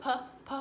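"P, p" said as the bare sound p; isolated first sound. Shown as a demonstration. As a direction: sound out "P, p" as just the p sound. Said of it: "say the first sound of the word".

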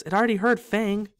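unnaturally high. Acted. Ron to Harry, terrified, voice jumping high. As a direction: let the voice jump up in fright.

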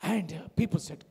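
usual speaking voice

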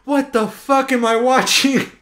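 incredulous, playing it up; retelling his own shock in the theater; retold disbelief. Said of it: "I'm in the audience going".

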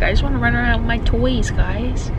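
light, high imitation of a play-hungry kid; voicing Lilia. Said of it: "She's like".

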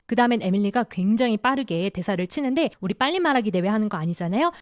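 ordinary talk, nothing marked

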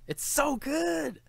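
a fan swooning over the film's framing, drawn out and high; gushing praise. Real, not acted. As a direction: stretch it out, swooning over the film. Praising the film, then repeating.

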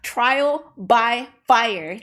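slow, loud Southern drawl on trial by fire; Southern accent. Shown as a demonstration. Showing viewers her Southern accent slowly. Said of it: "Southern accent when I say that".

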